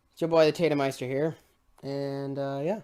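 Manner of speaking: ordinary talk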